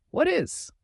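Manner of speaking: high startled yelp. Acted. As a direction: jump out of your skin. Barging in, startled by her.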